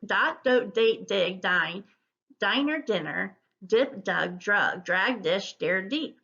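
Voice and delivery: words run together with hardly a gap; brisk, continuous fluency reading; quick word drill. Shown as a demonstration. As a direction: race through the word list. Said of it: "see if you can say 'em quickly".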